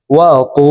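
ওয়া তু with a thick, heavy ত. Shown as a demonstration. Teacher showing the heavy letter to viewers. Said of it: "দেখুন এইভাবে পড়তে হবে".

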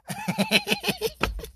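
very high, staccato giggle; laughing. Real, not acted. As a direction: break into a high, rapid cackle. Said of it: "Neebeererayo ewange nenseka".

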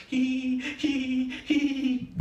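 high siren-like hee hee hee; siren wail. Retold or imitated. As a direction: imitate a wailing siren. Kids playing, siren imitation.

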